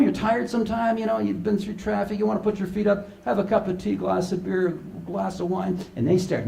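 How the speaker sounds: normal talking voice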